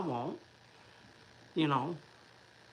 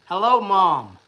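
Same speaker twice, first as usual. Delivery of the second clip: loud hello; 3 semitones higher, 12 dB louder, slower than usual. Real, not acted. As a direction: call out hello to mom on the stream. Greeting his mom on stream.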